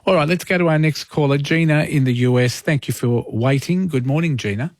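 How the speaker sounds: ordinary talk, nothing marked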